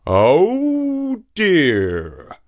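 high worried oh dear; dismayed donkey. A narrator's reading. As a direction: gasp in dismay. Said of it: "cried Punda".

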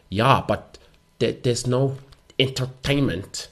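slow German-accented attendant breaking bad news. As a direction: put on a halting German accent, stretching the words. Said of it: "I'm not gonna do a German accent".

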